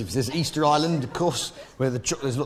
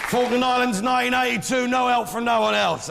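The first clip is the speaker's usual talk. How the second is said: mock indignation; 7 semitones higher than usual. Rattles off war grievance to audience.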